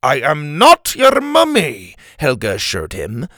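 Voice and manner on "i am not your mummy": high, loud, slow denial; indignant correction. Acted. Helga corrects concussed Doctor Rex, indignant. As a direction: set them straight, stressing each word.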